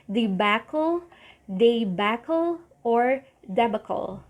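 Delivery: debacle as dee-BACK-ul, day-BACK-ul, DEB-uh-kul; three takes of debacle; variant pronunciations. Shown as a demonstration. Teacher showing three ways to say debacle. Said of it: "you can either say".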